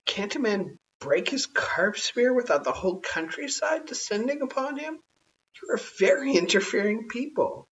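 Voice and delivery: lightly voiced indignant man. A narrator's reading. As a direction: protest with mild indignation. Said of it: "said Tugumai".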